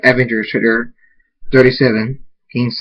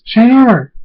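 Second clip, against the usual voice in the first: high, loud, delighted greeting; happy exclamation; joyful call; 11 semitones higher, 6 dB louder than usual. Acted. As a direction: cry out to a reunited brother. Sister greets brother joyfully.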